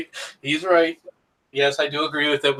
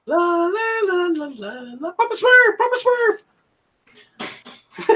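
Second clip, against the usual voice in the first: very high, squeaky cartoon Smurf; sing-song la-las into a high Smurf squeal; 13 semitones higher than usual. Shown as a demonstration. Streamer shows off an accent to his co-host, Smurf voice. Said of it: "Would you like me to do the Smurf accent?".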